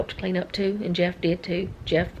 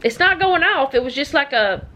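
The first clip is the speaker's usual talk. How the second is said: higher, raised explanation; calling out; 7 semitones higher, 3 dB louder than usual. Real, not acted. Explaining the alarm to Noah, calling out. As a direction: call back to someone out of the room.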